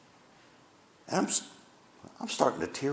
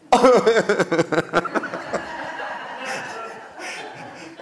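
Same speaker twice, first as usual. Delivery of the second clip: a burst of fond laughter; real laugh; 9 semitones higher than usual. Real, not acted. Pastor laughing at his son's proud words.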